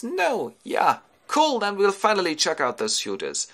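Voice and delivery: high, excited youngster voice; thrilled son. Acted. Telemachus learning this is his father, excited high voice. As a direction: burst out in high-pitched delight.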